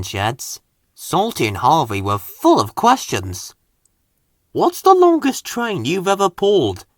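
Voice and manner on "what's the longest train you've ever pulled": higher, eager asking; eager question. Acted. Harvey eagerly quizzing Murdoch in the sheds. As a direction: pepper him with curiosity. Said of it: "asked Harvey".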